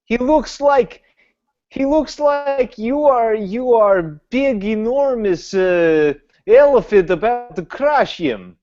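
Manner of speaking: high, exaggerated incredulous; mock indignant. Virion tells the wizard he looks huge, high and incredulous. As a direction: paint him as an elephant in a high incredulous voice.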